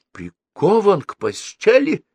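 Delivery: high, drawn-out incredulous question; dismayed cry with rising end; shocked exclamation. A narrator's reading. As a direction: echo it in alarm, pitch leaping up. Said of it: "воскликнул Старжин Фиц".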